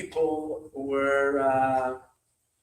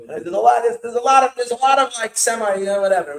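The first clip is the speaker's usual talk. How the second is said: loud dismissive; 6 semitones higher, 10 dB louder than usual. Retold or imitated. Mocking dismissive demon leader.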